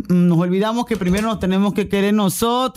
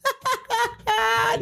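high laugh; 4 dB quieter than usual. Real, not acted. Laughs after teasing answer.

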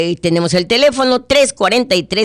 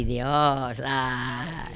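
usual speaking voice

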